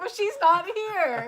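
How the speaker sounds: slow, loud, very high retort; loud retort pointing out the absent daughter; loud sarcastic reply. Retold or imitated. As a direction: spell out the obvious loudly and slowly. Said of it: "And I said".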